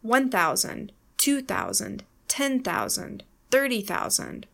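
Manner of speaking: ONE, TWO, TEN, THIRTY stressed before thousand; stressed leading number. Shown as a demonstration. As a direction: punch each number, let thousand fall away. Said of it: "then they are stressed".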